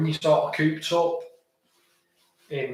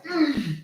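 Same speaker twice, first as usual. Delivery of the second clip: pained mm; 12 semitones higher than usual. Retold or imitated. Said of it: "and they go".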